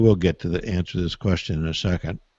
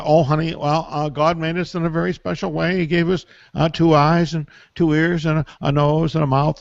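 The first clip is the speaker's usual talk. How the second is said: higher, fussy mother explaining; higher-pitched mom voice, nervously over-explaining; flustered mom; 7 semitones higher than usual. Retold or imitated. Young mom lecturing her bathing son, higher flustered voice.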